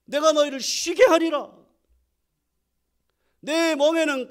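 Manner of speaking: high, loud preaching cadence; full-force preaching. Acted. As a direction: proclaim it to the whole congregation.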